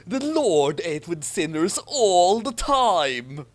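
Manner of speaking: slow sanctimonious Harry, lightly voiced; pious Harry voice. A narrator's reading. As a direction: deliver it like a sermon point.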